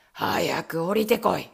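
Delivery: low, slow, loud villain's command; Bluebeard shouting. Acted. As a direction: bellow it like a brute. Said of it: "と青ひげは叫びました".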